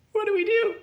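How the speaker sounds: pregunta aguda y asustada; pánico. Retold or imitated. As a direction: grita con pánico. Trapped at a deadly drop.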